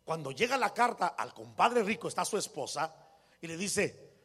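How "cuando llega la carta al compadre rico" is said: usual speaking voice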